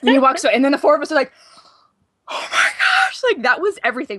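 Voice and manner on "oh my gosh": very high retold fangirl squeal; starstruck squeal. Four friends react after the star walks away, starstruck squeal. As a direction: mimic friends squealing in disbelief.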